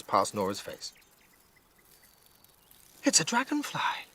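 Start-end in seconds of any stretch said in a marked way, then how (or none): 3.0-4.1 much higher, hushed wonder; girl spotting a huge dragonfly, high and awed; awed child. Acted. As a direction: whisper it up high in awe. Narrator voices discovery.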